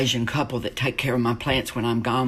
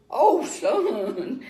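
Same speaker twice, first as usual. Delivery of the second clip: a higher, half-laughing groan of a pained dad; mock anguish from a bewildered father; as if in deep pain; 11 semitones higher than usual. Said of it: "as if he was in deep pain".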